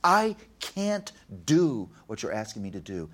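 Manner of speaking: imitated child's outburst, loud at first then falling away; retold frustrated protest, punched word by word; burst of frustration. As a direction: burst out on the first words, exasperated. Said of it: "then a burst of frustration".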